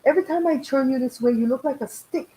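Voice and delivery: daughter's voice. Retold or imitated. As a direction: imitate the daughter teasing. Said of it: "And she goes".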